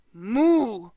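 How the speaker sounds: long moo rising and falling; cow moo. A narrator's reading. Bull spirit offering a gift, playful moo.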